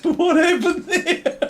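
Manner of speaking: high, laughing question. Real, not acted. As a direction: ask it through a fit of laughter. Laughing at the game.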